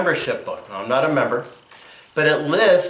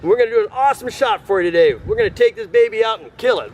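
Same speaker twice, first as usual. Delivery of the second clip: high, fast hyped-up hunter; excited host; 7 semitones higher than usual. Acted. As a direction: pump it up like a TV hunter. Hammy hunting show host.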